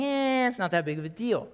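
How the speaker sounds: high, shrugging brush-off; dismissive. Retold or imitated. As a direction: toss it away like it doesn't matter. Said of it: "These people think".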